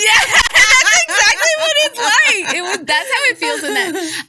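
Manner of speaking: loud, high burst of laughing agreement; real laughing outburst, high and loud. Real, not acted. Listener's comparison hits home.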